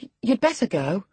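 put-on high, faltering girl; nervous stammer. A narrator's reading. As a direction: stutter the start, uneasy. Catherine stammering.

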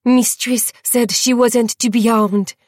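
enraged man roaring the mistress's command; roared. A narrator's reading. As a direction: bellow the order. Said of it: "as he roared".

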